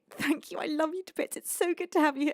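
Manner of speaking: usual speaking voice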